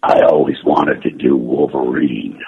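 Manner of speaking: low, slow growl; gruff, menacing growl; low gruff Wolverine voice. Acted.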